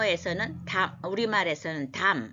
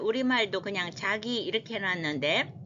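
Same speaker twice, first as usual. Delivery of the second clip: ordinary delivery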